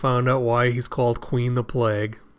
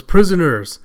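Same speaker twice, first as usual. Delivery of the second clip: raised, louder announcing voice; calling out; 4 semitones higher, 7 dB louder than usual. Acted. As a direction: address a crowd of prisoners. Announcement to the prisoners.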